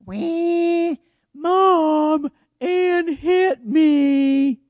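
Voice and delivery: put-on high, loud, long-drawn childish wail; mock crying wail. Little sister tattling to Mom, mock wailing. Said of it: "She cried".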